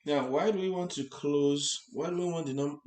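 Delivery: ordinary delivery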